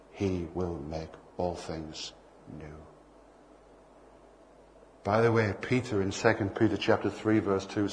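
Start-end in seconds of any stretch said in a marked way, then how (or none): 0.1-2.8 softer, every word stretched out; soft slow promise. Acted. God reassuring David about the planet, soft and slow. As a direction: say it gently, slowly, as a promise.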